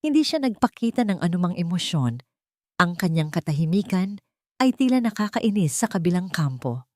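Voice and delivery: plain reading voice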